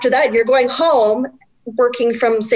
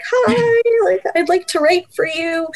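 high, small, timid voice; tiny squeaky voice; 9 semitones higher, slower than usual. Retold or imitated. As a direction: squeak it out shyly. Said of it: "in my tiny little squeaky voice".

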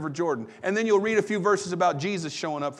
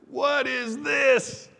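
slow, drawn-out, higher cry of amazement; stunned people calling out in wonder; astonished crowd; 8 semitones higher, much slower than usual. Acted.

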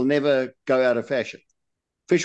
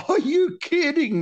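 much higher, mock-astonished question; mock disbelief. Glossing the Aussie reply, high mock astonishment. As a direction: squeak it out like you can't believe the tall tale. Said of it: "In other words".

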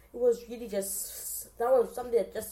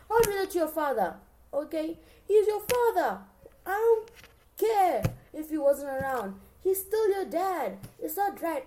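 higher and louder, scolding the son; outraged scolding; 7 semitones higher, 6 dB louder than usual. Real, not acted. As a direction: tell him off like a betrayed parent. Outraged rant at Berlin's son.

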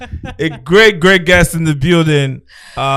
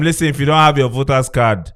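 ordinary talk, nothing marked

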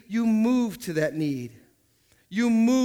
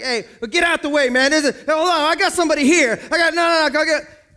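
pushy crowd shout; 6 semitones higher, 5 dB louder than usual. Retold or imitated. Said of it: "we're like".

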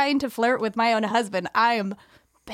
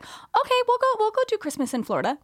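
much higher, quick eager mom voice; 11 semitones higher than usual. Retold or imitated. Voicing mom.

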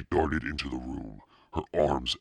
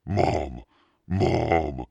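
loud, raised frightened call; calling for Mom; 3 semitones higher, 6 dB louder than usual. A narrator's reading. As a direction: call out for your mother, acted panic. Scared brother calling for mother.

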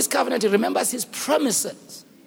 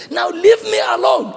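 high, raised, emphatic dismissal; very angry God; 7 semitones higher, 4 dB louder than usual. Acted. God tells Moses to back off, very angry. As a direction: send Moses away in anger. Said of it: "He's very angry".

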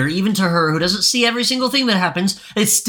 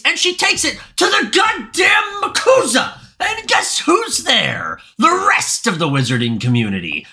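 ranting shout; 7 semitones higher than usual. Real, not acted. Ranting build-up.

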